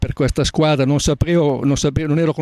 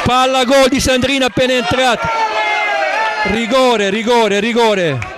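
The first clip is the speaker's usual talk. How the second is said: excited commentary; 10 semitones higher than usual. Real, not acted. Penalty chance, live call.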